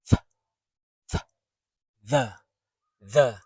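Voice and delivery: isolated "th", then a strong "the" with tongue out; tongue poked out TH. Shown as a demonstration. Teacher exercising the tongue, exaggerated TH. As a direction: overdo the th in "the". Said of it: "poke your tongue out a little bit more".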